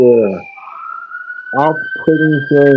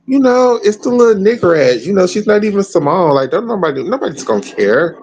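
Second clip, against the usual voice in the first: mocking Romanian critics; 8 semitones higher than usual. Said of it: "can see the Romanians like".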